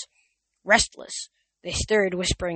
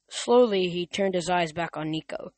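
ordinary delivery

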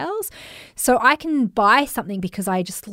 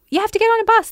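high, louder mock announcement; imitated transport staff; 13 semitones higher, 5 dB louder, faster than usual. Transport staff redirect passengers, high imitated call. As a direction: lift it high and call out the instruction. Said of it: "They were like".

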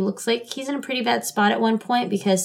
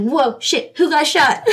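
louder, higher startled reaction with a laugh; retold shock; 7 semitones higher, 4 dB louder, slower than usual. Recalling her reaction to a sudden gunshot, startled. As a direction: blurt it out, half laughing. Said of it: "and I was like".

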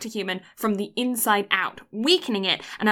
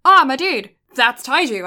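excited shout; 4 semitones higher, 8 dB louder than usual. Retold or imitated. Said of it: "And then he's like".

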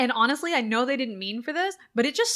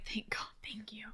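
whispered thanks; 15 dB quieter than usual. Retold or imitated. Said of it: "And I'm like".

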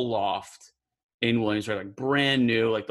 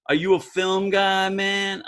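higher, drawn-out New York street voice; New York street talk; 5 semitones higher, slower than usual. Retold or imitated. Abel quizzing the narrator about film, in New York street talk. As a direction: ask it like a neighborhood guy. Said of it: "he kinda talks like, like bebop".

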